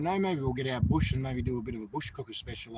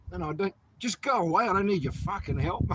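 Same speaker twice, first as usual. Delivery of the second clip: higher, louder irritated outburst; exasperated refusal; 7 semitones higher, 5 dB louder than usual. Acted. Man telling officials to leave him alone, exasperated. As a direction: wave off unwanted help, fed up. Answers imagined officials, rejecting help.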